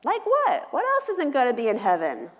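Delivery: put-on very high, eager kid voice; child piping up. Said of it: "another child pipes up".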